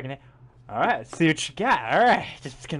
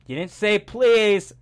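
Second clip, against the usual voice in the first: mock stern; 5 semitones higher, 7 dB louder, much slower than usual. Teasing brother, demanding manners.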